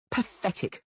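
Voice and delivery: high scornful cry, falling at the end; scornful shout. Acted. Blonde woman mocks Dandelion in bed, scornful. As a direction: spit the word out in contempt. Jilted woman screams insult.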